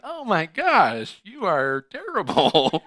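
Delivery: high, loud, slow "oh my gosh"; mock shock. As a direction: draw out the disgusted surprise. Shock on meeting face to face.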